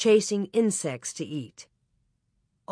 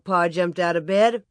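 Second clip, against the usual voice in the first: usual speaking voice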